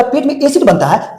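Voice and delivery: mock puzzled student question; imitating a student. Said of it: "तो तुम कहेगा". (संदेह करते छात्र की नकल)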